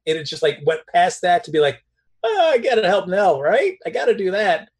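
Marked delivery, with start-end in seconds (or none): 2.2-4.7 mock concern. Mimics the character's new motive.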